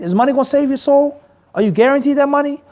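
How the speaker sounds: ordinary talk, nothing marked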